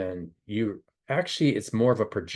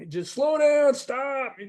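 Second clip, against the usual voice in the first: retold yelling; much slower than usual. Begging brother in the tow car to stop, high yell. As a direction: holler it up the road, half replaying the panic. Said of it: "arms screaming out the window".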